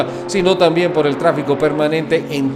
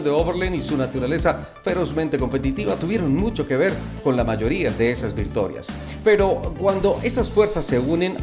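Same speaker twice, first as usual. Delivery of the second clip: documentary narration; 5 dB quieter than usual. Acted. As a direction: narrate quietly over the music. Documentary voiceover over music.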